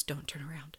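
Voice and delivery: softly, a quiet urgent warning; hushed warning. Retold or imitated. Friend quietly warning her not to turn, hushed. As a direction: keep it hushed so he won't notice. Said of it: "and she goes".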